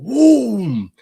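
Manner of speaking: loud high engine roar imitation; mock dragster launch whoom. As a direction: shout the engine noise. Mimicking drag car launch.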